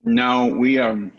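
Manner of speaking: chewing ice while talking; ice in mouth. Real, not acted. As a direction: talk around a mouthful of ice. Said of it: "sorry, I'm chewing my ice".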